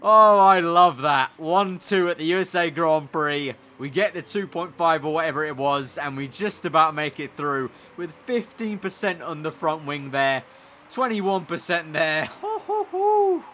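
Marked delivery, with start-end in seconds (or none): 0.1-3.6 loud, slow, savouring shout; delighted cheer. Real, not acted. Streamer savouring the one two win, loud and slow. 12.4-13.4 very high hooting laughter; high gleeful laugh. Real, not acted. Streamer hooting with laughter at the win.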